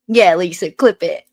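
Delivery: loud jeering echo; heckling over the clip; mocking echo. Real, not acted. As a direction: throw the words back loudly. Mockingly echoes the clip's words.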